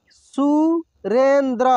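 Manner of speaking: Surendra drawn out slowly, syllable by syllable; slow sounding out. Shown as a demonstration. Teacher slowly sounds out Surendra for students. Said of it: "एक एक मात्रा को अलग अलग करके".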